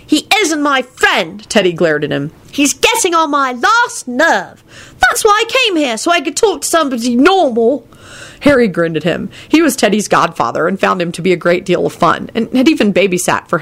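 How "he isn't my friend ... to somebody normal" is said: put-on a kid grumbling about a pest; high child voice, annoyed. Teddy voiced by narrator.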